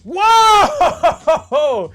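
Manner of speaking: loud, high amazed gasp of a word; genuinely floored by the find; surprised whoa. Real, not acted. Surprised by valuable shoe.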